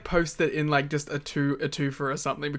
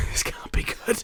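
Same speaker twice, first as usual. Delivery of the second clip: hushed dread, breathy and low; a dismayed aside under the breath; whisper; 4 dB quieter than usual. Retold or imitated. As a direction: whisper it like you fear what's coming. Dreading a bad wrestling segment.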